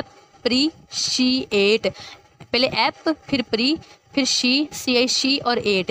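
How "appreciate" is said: Appreciate stretched very slowly, every syllable drawn out; careful slow demo. Shown as a demonstration. Teacher showing students how to say it, very slow. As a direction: sound out Appreciate slowly for the class. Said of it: "हम word को कैसे बोलते हैं?".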